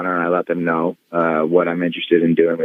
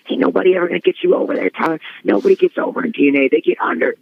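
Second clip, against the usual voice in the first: much higher, fast, ranting impression; irate Cornette; 11 semitones higher, faster than usual. Cornette ranting at Tyler over the phone, high and fast. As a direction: rattle it off high and fast like a furious old booker. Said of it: "he was so irate".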